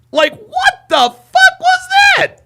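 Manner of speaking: very high, very slow disbelieving squeal; drawn-out high-pitched outrage; shocked squeal. Real, not acted. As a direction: stretch it out in shrill disbelief. Disbelief at the clip.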